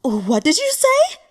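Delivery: high, loud outraged demand; furious retort. Acted. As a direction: snap it back at him in fury. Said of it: "got angrier at his words".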